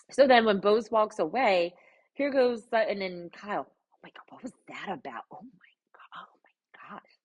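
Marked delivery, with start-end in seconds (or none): 3.7-7.1 breathless whispered gasp of disbelief; shocked whisper. Real, not acted. Vlogger reacting to a TV scene, shocked whisper. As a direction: whisper it as if you cannot believe your eyes.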